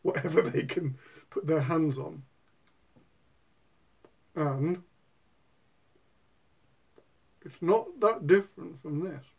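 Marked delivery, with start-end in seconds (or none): 4.3-9.2 talking around pipe stem; speaking with clenched teeth; pipe in mouth. Real, not acted. Seen 2: Pipe stem clenched in teeth throughout while he talks and gestures.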